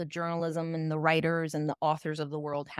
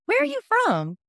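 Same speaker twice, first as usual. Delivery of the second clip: very high, loud mock interrogation; hammed-up, high-pitched nosy question; pointed othering question; 6 dB louder than usual. Stranger asking who someone is, pointedly othering. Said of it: "Que es diferente from".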